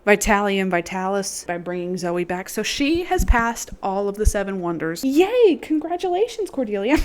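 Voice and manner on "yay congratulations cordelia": excited cheer. Real, not acted. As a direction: cheer her on like a fan. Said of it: "cheering the result".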